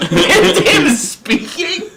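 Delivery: very high, slow question through laughter; laughing squeak rising at the end; incredulous laughing. Real, not acted. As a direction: repeat it in helpless disbelief. Repeats the lie in disbelief.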